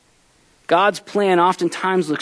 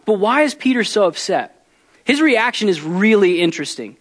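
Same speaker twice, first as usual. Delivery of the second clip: ordinary delivery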